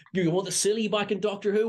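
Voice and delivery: "Who" lifted high as a question; rising excited question. Real, not acted. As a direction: ask "Who" with a big rising lift. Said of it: "We can put the silly back".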